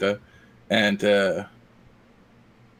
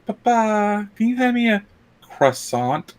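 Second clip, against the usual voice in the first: put-on high, sweet little-girl voice asking her dad; little French girl; 12 semitones higher than usual. Retelling a YouTube clip, French girl asking Papa, voiced high and sweet. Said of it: "a French girl who was like".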